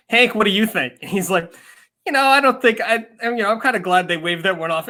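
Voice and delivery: lightly voiced studio host and analyst; mock TV panel. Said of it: "they go back and they're like".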